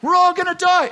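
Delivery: shrieked high and loud, over and over; screaming. Acted. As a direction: yell it in full panic. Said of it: "the man in front starts screaming".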